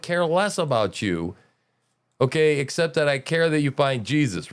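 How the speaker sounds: normal talking voice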